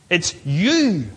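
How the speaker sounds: "you" stretched on a rise and fall; emphatic, drawn-out "you"; stress on you. Shown as a demonstration. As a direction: lean long on "you". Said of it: "It's stressed".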